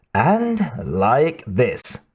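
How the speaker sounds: drawn out very slowly; slow taunt. A narrator's reading. Benedict shows off eating squid to Saigo, slowly taunting. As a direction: stretch each word to goad him.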